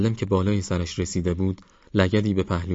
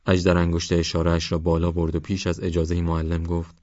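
ordinary delivery

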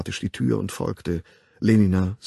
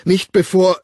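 high, loud, broken-off cry; distraught exclamation; 10 semitones higher, 9 dB louder than usual. A narrator's reading. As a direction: call it out in distress, stopping short. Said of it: "rief der Wilde".

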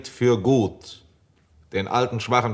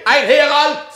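high, loud shout; calling out; 6 dB louder, slower than usual. Acted. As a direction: yell for the herald. Calling for a herald.

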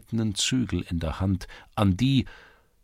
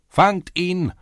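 high loud cry from far down the lane; distant shouting; 11 semitones higher, 6 dB louder, slower than usual. A narrator's reading. Crowd shouting across the lane to catch him. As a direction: call from the other end. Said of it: "erscholl es".

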